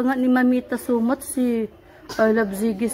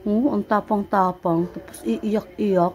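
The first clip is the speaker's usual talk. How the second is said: slow teasing; 4 semitones lower, much slower than usual. Real, not acted. Grandma teasing the upset toddler.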